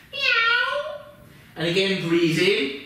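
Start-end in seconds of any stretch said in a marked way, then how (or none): 0.1-1.0 very high squeaky mock meow; cat meow. Yoga teacher to children in cat pose, high playful meow. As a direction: meow like a little kitten, way up high. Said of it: "What does a cat say?".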